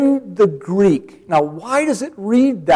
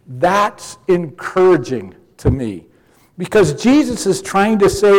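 usual speaking voice

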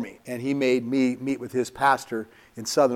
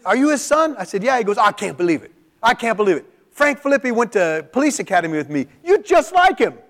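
higher and louder, bursting with disbelief; amazed stranger; 8 semitones higher, 5 dB louder than usual. Retold or imitated. Stranger in the store marvels he's Frank's son, excited and loud. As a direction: play the stunned old cop who can't believe it.

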